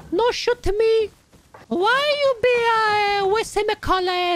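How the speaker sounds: put-on lightly done Italian nonna accent, broken English; an old Italian cleaning lady pleading at the door in a mild Italian accent; Nonna Maria Italian accent. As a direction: play a little old Italian grandma who wants to clean, thick Italian accent. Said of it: "I can naturally do a good Italian accent".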